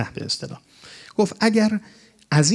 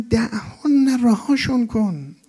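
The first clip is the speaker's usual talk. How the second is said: raised pleading quote; 8 semitones higher, much slower than usual. Retold or imitated. Prophet tells Omar leave them.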